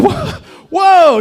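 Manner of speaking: loud, high yelp of shock. Retold or imitated. Dad reacting to daughter's harsh warning, loud shocked outburst.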